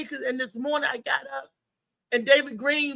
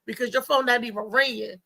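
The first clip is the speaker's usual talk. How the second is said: usual speaking voice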